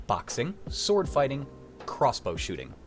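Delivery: usual speaking voice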